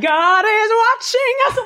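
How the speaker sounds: hammed-up pious alarm; mock alarmed warning. Host teases the guest with a mock alarmed warning.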